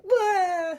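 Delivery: loud, high mock cry; mock wail. Reacting badly in a conversation, loud mock wail. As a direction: wail it like a kid. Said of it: "you're just like".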